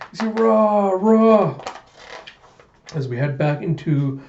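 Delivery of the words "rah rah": high, loud drawn-out yell; repeated high-pitched war cry; battle roar. Acted. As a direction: imitate a fighter's roar. Battle cries imitated.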